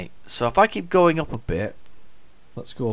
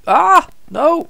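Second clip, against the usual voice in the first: high, loud alarmed cry; real panic, cry out; scared horror game streamer; 8 dB louder than usual. Real, not acted. Almost crashes again.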